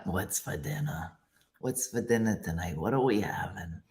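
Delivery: low, soft character asking about dinner; lightly voiced low character; put-on character voice. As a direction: drop into the bit voice. Voice bit requested by chat.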